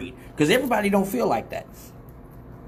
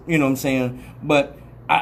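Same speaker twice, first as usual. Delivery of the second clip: usual speaking voice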